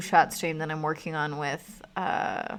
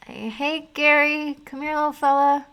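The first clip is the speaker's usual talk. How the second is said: high, sing-song call to a pet; coaxing pet voice; 9 semitones higher, 6 dB louder than usual. Acted. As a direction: lure the bunny over sweetly. Coaxing a rabbit over.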